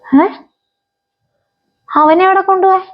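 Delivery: shocked alarm. Acted. Mother hears her son will be taken, shocked alarm.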